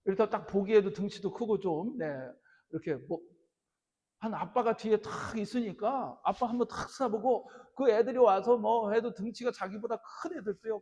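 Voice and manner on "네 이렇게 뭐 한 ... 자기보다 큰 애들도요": usual speaking voice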